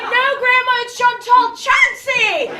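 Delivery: loud, high, slow correction; strained loud correction; exasperated correction. Retold or imitated. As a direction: lose patience with grandma. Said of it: "I'm like".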